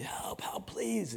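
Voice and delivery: acted high, thin plea for help; pleading for help. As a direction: beg for help in a small high voice. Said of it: "he's yelling for help".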